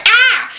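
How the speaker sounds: a high, startled crowd yelp; high mock scream. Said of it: "everybody's like".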